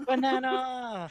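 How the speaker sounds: high, slow silly minion cry. Retold or imitated. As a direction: squeal it like a little yellow minion. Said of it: "That was my minion impression".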